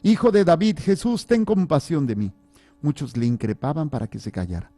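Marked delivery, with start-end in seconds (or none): 0.0-2.3 loud high-pitched pleading cry; acted desperate shout. A narrator's reading. Reading the blind man calling to Jesus of Nazareth, crying out. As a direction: shout out for mercy, voice raised high. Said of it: "se puso a gritar".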